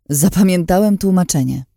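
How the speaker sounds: snapped. A narrator's reading. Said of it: "capnął tata".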